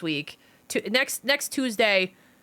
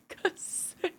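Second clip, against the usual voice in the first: whisper; 9 semitones higher, 10 dB quieter than usual. Real, not acted. Whispered echo.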